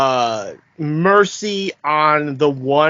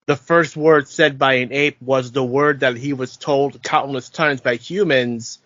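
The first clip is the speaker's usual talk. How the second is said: ordinary talk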